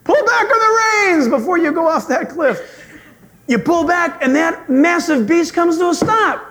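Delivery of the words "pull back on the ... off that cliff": higher, laughing mock alarm; urgent warning. Riding guide warning the rider near the cliff. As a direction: warn them off the cliff. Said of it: "when that guy goes".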